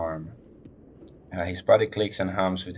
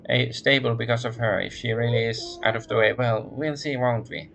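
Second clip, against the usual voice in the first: brisk, higher businesslike woman voice; lightly voiced nasal woman, all business; 5 semitones higher than usual. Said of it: "becomes more businesslike".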